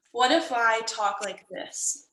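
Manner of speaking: slow, subdued sad voice. Shown as a demonstration. Teacher demonstrating a tone for students to guess, sad. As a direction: drag the words out sadly. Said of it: "I was trying to communicate sad".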